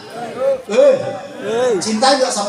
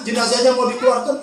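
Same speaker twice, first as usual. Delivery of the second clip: ordinary delivery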